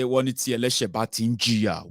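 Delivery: normal talking voice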